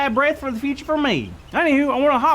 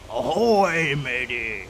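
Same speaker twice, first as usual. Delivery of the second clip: pirate greeting; 9 semitones lower, much slower than usual. Shown as a demonstration. [name] teaching kids a pirate greeting. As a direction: lower gruff pirate hail, fading at the end. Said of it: "to talk like a pirate".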